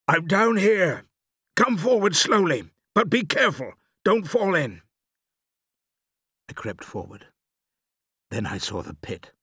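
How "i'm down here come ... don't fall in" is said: high, loud cautioning call. A narrator's reading. Father warning his son away from the edge, calling up.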